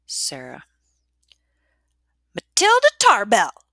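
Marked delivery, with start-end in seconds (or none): 2.3-3.6 high, loud angry shout; sharp, ringing call. A narrator's reading. Matron summoning a girl in trouble, sharp shout. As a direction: ring it out across a dormitory. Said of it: "voice rings sharply, strongly throughout the dormitory".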